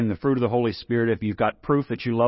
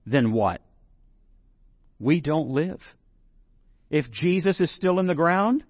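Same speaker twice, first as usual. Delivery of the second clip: ordinary talk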